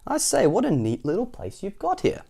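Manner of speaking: high, swooping character voice; admiring cousin. A narrator's reading. Cousin admires the neat house.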